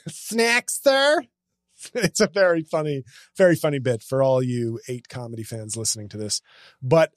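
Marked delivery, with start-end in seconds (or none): 0.1-1.2 high, lilting mock steward voice; flamboyant flight attendant. Comedian retelling Giraldo's bit, high mock steward. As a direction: camp it up on the word. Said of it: "the guy is going".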